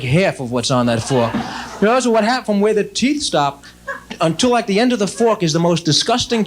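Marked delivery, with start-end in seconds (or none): none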